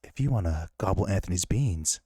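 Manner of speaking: low husky come-on voice; Whisper Daddy voice. Pretend. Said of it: "in the Whisper Daddy voice".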